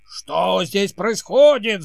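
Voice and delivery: loud, slow angry shout. Acted. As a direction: bellow it like a cranky king. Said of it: "закричал король".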